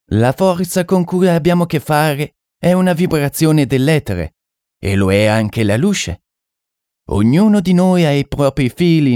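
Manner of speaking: high-pitched French mystic, explaining; French medium. Acted. French medium explaining the darkness to sitters, high-pitched and assured.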